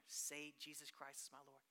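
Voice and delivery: soft, low insistent repetition; hushed exhortation; quiet firm urging. Retold or imitated. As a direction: press her gently to say it. Exhorting the girl softly.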